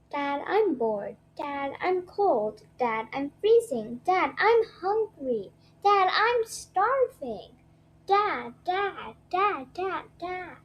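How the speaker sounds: whiny, slow, building to high nagging; whining child. Acted. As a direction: nag Dad over and over. Kids whining at Dad.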